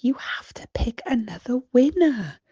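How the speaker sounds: soft unvoiced whisper; mother whispering advice to her daughter; quiet whisper. A narrator's reading. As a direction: whisper it gently aside. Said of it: "Mummy Pig says quietly".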